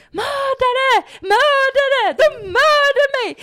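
put-on high, loud hysterical child yelling murder; screaming. Child running into the waiting room, hysterical screaming. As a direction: shriek accusations at the top of your lungs, slowing on the last. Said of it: "Och skriker".

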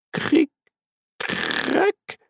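high, small crackling "cric, crac"; tiny splitting sounds; imitated cracking. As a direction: voice the cracks as little high noises. Narrator imitates the dome cracking.

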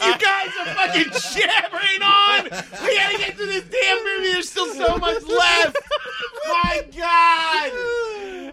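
high-pitched, drawn-out yell of frustration; exasperated outburst. Real, not acted. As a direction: wail at friends who won't stop jabbering. Scolds co-hosts for rambling.

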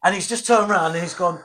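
ordinary talk

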